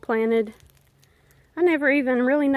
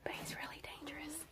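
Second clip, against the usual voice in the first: whispering; 3 semitones higher, 27 dB quieter, faster than usual. Real, not acted. Whispering near a wild animal.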